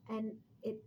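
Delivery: ordinary talk, nothing marked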